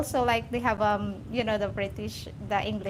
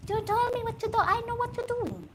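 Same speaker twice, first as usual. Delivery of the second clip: very high mock Indian accent; hammed-up, high-pitched Indian accent; 13 semitones higher than usual. Comedian repeating the retort, very high put-on Indian accent. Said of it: "Indian accent".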